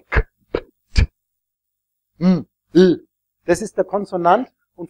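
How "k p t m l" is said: "K, P, T, M, L" as bare clicks and hums with no vowel; pure consonant sounds. Shown as a demonstration. Teacher demonstrating pure consonants, "K, P, T, M, L" as bare vowelless sounds. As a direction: sound out "K, P, T, M, L" without letter names, each one separate. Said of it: "Die reinen Konsonanten sind Knacklaute".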